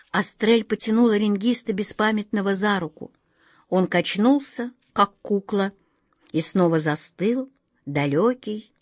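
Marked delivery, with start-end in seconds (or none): none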